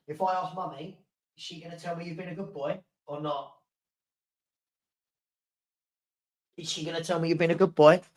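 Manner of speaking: hushed, quick murmur to the dog; soft puppy talk. Real, not acted. Owner quietly teasing Stanley about Mummy, hushed. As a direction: tease him gently and softly.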